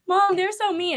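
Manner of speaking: high-pitched mock kid whine; childish complaint. Wrestling fan as a kid complains to mom, high mock whine. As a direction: play your younger self tattling. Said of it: "and I'd be like".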